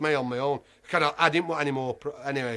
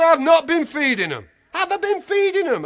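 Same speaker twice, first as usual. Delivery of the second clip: loud, high indignant denial; indignant shout; 12 semitones higher, 9 dB louder than usual. Retold or imitated. To the zoo keeper, a loud high indignant denial. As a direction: bellow back that you never fed them. Indignant retort in retold row.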